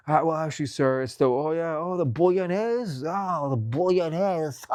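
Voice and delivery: high, hammed-up snooty waiter; mock know-it-all, drawing out Italian "bolognese"; pretentious corrector. Waiter correcting a diner, high mock-pretentious voice. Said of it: "they have to correct you".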